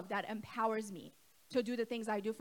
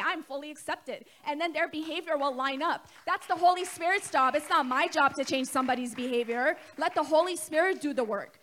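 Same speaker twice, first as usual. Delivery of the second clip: preaching; 4 semitones higher, 6 dB louder than usual. Acted. Preaching builds to full force.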